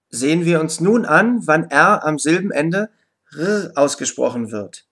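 ordinary talk

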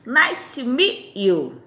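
Nice to meet you said very slowly; slow repeat-after-me model; slow teaching demo. Shown as a demonstration. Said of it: "Prazer em conhecê-lo".

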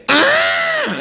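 high, loud strangled wail; Elephant Man cry. Retold or imitated. Elephant Man wailing at the kind doctor, mock anguished high cry. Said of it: "he turned to Anthony Hopkins and he said".

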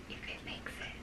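whispered aside. Real, not acted. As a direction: whisper it under your breath.

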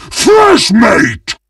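high, excited shout at fresh prey; gleeful battle cry. Acted. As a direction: yell it like a ravenous butcher.

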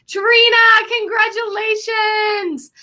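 high, slow, drawn-out congratulations; excited greeting. Real, not acted. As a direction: sing out the congratulations to her, beaming. Greeting a graduate joining the call.

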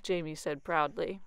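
plain reading voice

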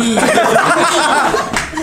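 loud high burst of laughter. Real, not acted. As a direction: crack up loudly.

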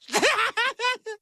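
high-pitched tipsy cackle; high, silly drunken giggle; drunken laugh. Acted. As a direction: laugh hard at your own joke, drunk. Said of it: "Stewie, you're drunk".

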